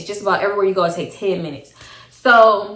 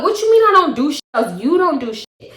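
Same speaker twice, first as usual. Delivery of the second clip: higher and louder, indignant; retold angry retort; 7 semitones higher, 3 dB louder than usual. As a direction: fire back, offended. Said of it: "And I'm like".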